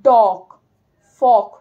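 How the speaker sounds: teacher modelling the short o; short o demo. Shown as a demonstration. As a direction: clip the o in ডগ, ফক. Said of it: "এটা কিন্তু শর্টে উচ্চারণ হয়".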